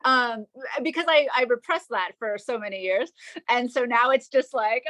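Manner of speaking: ordinary talk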